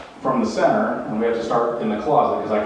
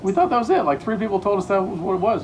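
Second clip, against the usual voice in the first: much higher indignant what; shocked disbelief; 9 semitones higher than usual. Retold or imitated. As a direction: squeak out shocked protest. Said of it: "we're like".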